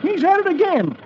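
very high, worked-up character voice; agitated Dolfo. Acted. Dolfo telling Roy of his trouble, agitated high voice. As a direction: protest in a high, excitable old-timer voice.

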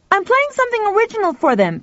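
put-on high boy's call from upstairs; high-pitched boyish shout; shouting back. A narrator's reading. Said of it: "Pal shouted back".

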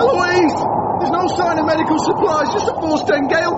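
high, strained calling; shouting over wind. Acted. Husband to wife beside the opened box, shouting over wind. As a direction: call out over a roaring gale.

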